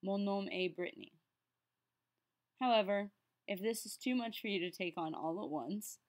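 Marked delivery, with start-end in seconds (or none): 0.0-1.1 Brittany in American English, "BRIT-nee", after the French; French phrase ending in a plainly American Brittany; Americanized name. Shown as a demonstration. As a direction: switch to an American accent for Brittany. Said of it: "saying your name in the Americanized version".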